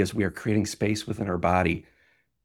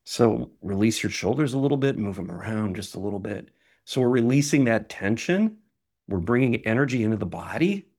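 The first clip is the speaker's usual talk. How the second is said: guided breathing. Acted. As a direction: talk them through each breath. Leads a guided breathing exercise.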